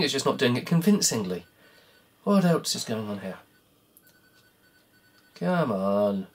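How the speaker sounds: normal talking voice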